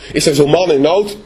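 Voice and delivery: ordinary delivery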